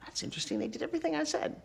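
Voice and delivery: hushed, near-whispered musing; voiced private thought. New host privately amazed staff obeyed her, hushed and wondering. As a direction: marvel under your breath, rising high.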